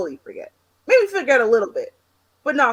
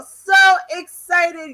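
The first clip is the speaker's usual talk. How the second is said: slow, higher, louder sung-out delight; drawn-out excitement; 8 semitones higher, 7 dB louder, much slower than usual. Real, not acted. Guest gushing about dancing to her song, stretched excitement. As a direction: stretch it out with glee. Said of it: "I'm so excited".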